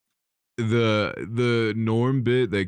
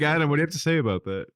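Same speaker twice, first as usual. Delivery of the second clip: fast host question; 5 semitones higher, much faster than usual. Retold or imitated. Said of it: "And he'd be like".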